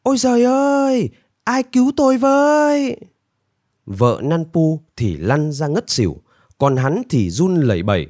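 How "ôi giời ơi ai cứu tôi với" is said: hammed-up high-pitched lament; pitiful wailing. A narrator's reading. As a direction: wail in panic for a rescuer. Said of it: "Nanpu kêu gào thảm thiết".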